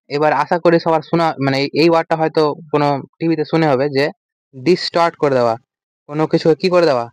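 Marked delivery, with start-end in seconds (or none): none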